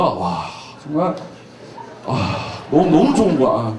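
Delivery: a hint of a dazzled admirer; lightly mimed swooning. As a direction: sigh it out like a smitten fan. Pastor mimics ogling a star.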